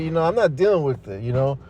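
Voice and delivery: usual speaking voice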